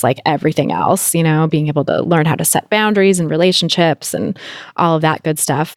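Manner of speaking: ordinary delivery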